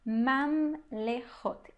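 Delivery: Mamlehot very slow, mam and le split apart; very slow classroom model of Mamlehot; syllable by syllable. Shown as a demonstration. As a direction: break Mamlehot into its syllables slowly. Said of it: "o segundo shvá está abrindo".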